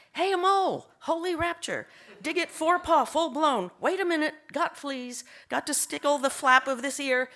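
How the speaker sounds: loping verse that bursts into doggy excitement; excited dog's poem. Acted. Poet reads her dog Gracie's sonnet to an audience, excitedly.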